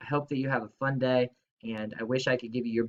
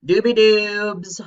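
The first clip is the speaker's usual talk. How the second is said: playful singsong; 6 semitones higher, 8 dB louder, slower than usual. Real, not acted. Teacher playfully calling student name.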